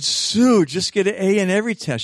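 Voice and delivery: high, loud urging; exhorting teacher. Retold or imitated. Teacher pushing Sue toward straight A's, insistent. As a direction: plead loudly, pitched well up.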